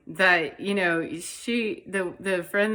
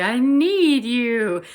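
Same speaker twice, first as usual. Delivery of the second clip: pleading; 6 semitones higher, 8 dB louder, much slower than usual. Retold or imitated. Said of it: "the call to say".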